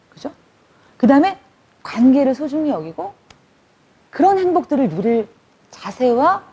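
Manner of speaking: ordinary talk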